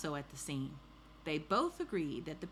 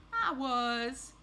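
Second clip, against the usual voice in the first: high, louder storybook admission; confessing thief; 8 semitones higher, 5 dB louder than usual. Acted. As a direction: own up in a high character voice.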